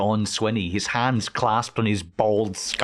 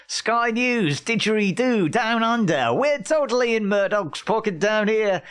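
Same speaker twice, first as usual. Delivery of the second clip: hammed-up Australian accent, pitched way up; 10 semitones higher than usual. Mocking Sky News Australia in a high Australian accent. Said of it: "I won't do an Australian accent again".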